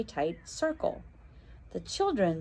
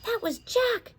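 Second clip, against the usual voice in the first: high, alarmed shout; a boy shouting on hearing a scream. Acted. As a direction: cry out in shock. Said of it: "he shouted".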